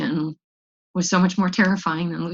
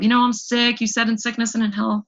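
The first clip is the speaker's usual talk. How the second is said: higher, louder pleading to her husband; manipulative plea; 5 semitones higher, 3 dB louder than usual. Retold or imitated. To husband walking out with their child, a manipulative plea. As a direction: guilt him into staying, pleading. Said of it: "every manipulative tactic I could think of".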